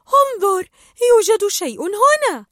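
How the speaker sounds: high, loud eager call; excited discovery. Acted. Dory finds something in the torpedo room, excited. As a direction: point out the find with delight. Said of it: "وقالت".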